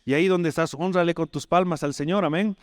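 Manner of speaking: high, intense pulpit exhortation; fervent preaching. Acted. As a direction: plead with the congregation, voice lifted high. Calls for applause to the Lord.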